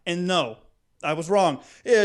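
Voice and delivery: usual speaking voice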